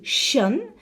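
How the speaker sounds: "shun" set apart with a clear sh; sound of shun. Shown as a demonstration. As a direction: say "shun" as a model ending, sh plus a short vowel and n. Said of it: "Pay particular attention to".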